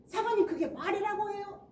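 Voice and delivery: high, loud outburst; indignant retort. Acted. Evangelist answering back to the pastor's wife, indignant. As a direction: fire back in outrage, acted. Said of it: "성령님이면 이렇게 안 한다고".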